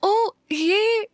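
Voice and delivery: high, drawn-out excited "oh"; sudden delight. A narrator's reading. Meera suddenly sees the henna, delighted surprise. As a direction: stretch both words, pitch leaping up. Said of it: "Meera एकदम से बोली".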